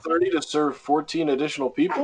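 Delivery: fast, high disbelieving question; incredulous. Real, not acted. Listener cutting in, stunned by the clerk's hours. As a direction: rattle it off in disbelief.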